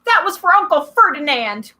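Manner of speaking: high, loud defiant yell; yelled back. A narrator's reading. Satyr yelling back at monster, defiant. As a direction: shout revenge triumphantly. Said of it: "Grover yelled back".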